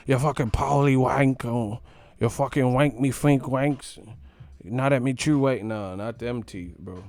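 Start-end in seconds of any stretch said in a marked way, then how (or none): 0.1-5.6 hammed-up London slang voice, slightly louder; mock British accent. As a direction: mimic English schoolkids trading insults. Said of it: "I had an accent and everything".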